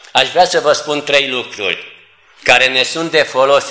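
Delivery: ordinary talk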